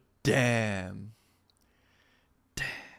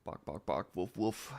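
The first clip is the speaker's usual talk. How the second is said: dog barks; 6 semitones lower, 13 dB quieter than usual. Retold or imitated. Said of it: "when she sees Deado".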